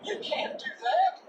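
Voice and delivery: high timid dog voice, surprised; timid sidekick dog. Acted. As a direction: squeak it out in disbelief. Drover's high voice.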